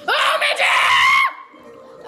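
long, high, stretched-out shout; drawn-out yell. Real, not acted. Gamer hollering slowly at the game onscreen. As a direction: bellow it slowly at the screen.

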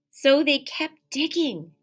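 lively, expressive read-aloud; animated picture-book reading; reading to children. Acted. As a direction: read it like storytime for little kids.

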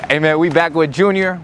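usual speaking voice

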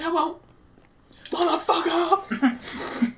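high, dragged-out mock yell; hammed-up high shriek of a curse. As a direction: stretch the curse out long and high. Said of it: "I'm like".

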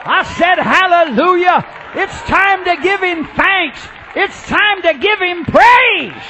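high, loud, stretched-out exhortation; rallying praise shout. Real, not acted. Preacher calling congregation to give praise, shouting. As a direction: draw out the last words in a shout.

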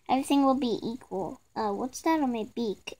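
normal talking voice

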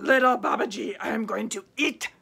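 a touch of a menacing tiger; tiger threat. A narrator's reading. Tiger telling the boy he will eat him, mild. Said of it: "it said to him".

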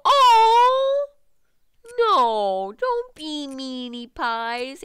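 loud, drawn-out wail of protest; hammed-up babyish pleading, high at first, very slow; mock dismay. Player begs game characters not to be mean, mock dismay.

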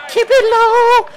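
squeaky taunt. Real, not acted. Dribbling past opponent in game.

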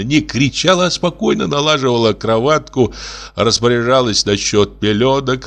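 ordinary delivery, nothing marked

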